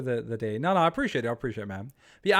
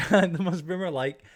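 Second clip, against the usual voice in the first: amused, drawn-out laughing delivery; 5 semitones higher, 6 dB louder than usual. Real, not acted. Teasing Ali for being boomer-like, laughing. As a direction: laugh while saying it. Repeats own joke while laughing.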